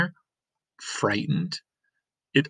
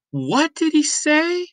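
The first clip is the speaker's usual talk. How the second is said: much higher, annoyed call from the crowd; 5 dB louder than usual. Acted. Townsperson heckling the mayor, higher and impatient.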